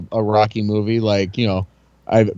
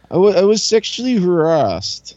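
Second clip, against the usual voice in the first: put-on New Jersey accent; 7 semitones higher than usual. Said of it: "like New Jersey".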